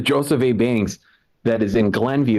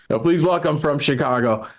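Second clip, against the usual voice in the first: announcer intro; 8 semitones higher, slower than usual. Real, not acted. Host introducing next comedian.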